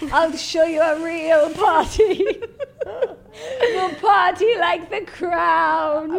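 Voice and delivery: high, loud and slow hammed-up boast; showing off for Colin, laughing; giddy mock brag; excited.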